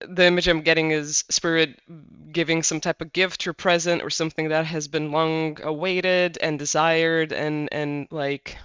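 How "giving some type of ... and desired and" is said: normal talking voice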